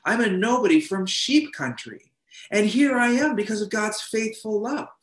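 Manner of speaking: put-on high, earnest voice of an old king marvelling at grace; humble king David. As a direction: speak as a king who still feels like a nobody.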